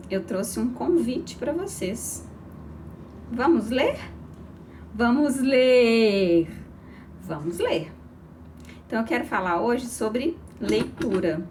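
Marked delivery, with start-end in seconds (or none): 3.3-4.0 LER rising as a question. Shown as a demonstration. Same invitation said three ways. 4.9-6.5 loud, long-drawn exclamation on LER; exclaiming. Shown as a demonstration. Same invitation said three ways. 7.2-7.9 low, falling LER, like a full stop; statement. Shown as a demonstration. Same invitation said three ways.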